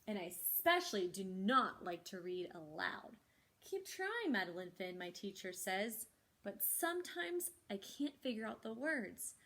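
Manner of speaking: lively, expressive picture-book reading; reading to children. Acted. Librarian reads the story to children, animated storytelling. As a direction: bring each line to life for the kids.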